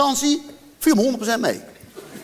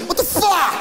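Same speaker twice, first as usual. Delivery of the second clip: outraged refrain; 10 semitones higher than usual. Real, not acted. Comedian rages about cutting art, exasperated shout. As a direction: throw it at the crowd.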